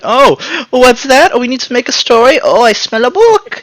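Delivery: very high, mocking put-on voice; gleeful company impression. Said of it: "now they're like".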